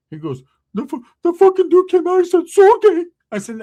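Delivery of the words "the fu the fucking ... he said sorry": put-on high, loud whimpering teen voice; crying cousin voice. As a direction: wail it like a scared kid cousin. Cousin Ricky retelling the fight.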